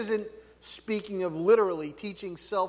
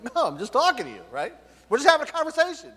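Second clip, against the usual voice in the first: loud, high, fast family-style protest; raised-voice, rapid-fire defensiveness; yelling while denying anger; 5 semitones higher, 5 dB louder, faster than usual. Acted. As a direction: insist loudly that you're not mad. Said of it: "But you're yelling at me".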